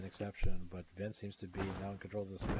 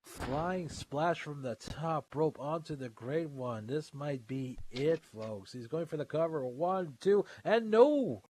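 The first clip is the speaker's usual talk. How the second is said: wrestling commentator; 7 semitones higher, 5 dB louder, slower than usual. Acted. Calls the pin and kickout.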